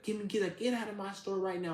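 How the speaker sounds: high-pitched imitated demand to leave; mock angry order. Store worker orders him out.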